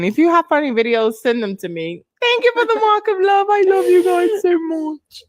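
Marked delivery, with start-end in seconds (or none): 2.2-5.2 sweet high thanks. Real, not acted. Streamer cooing thanks to viewers for a gift.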